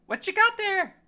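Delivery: very high, loud, nosy character voice; Tattle. Acted. Game master as a character walking over, high and loud. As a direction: squeal it out in a high-pitched character voice.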